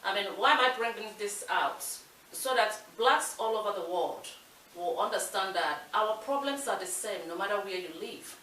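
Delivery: normal talking voice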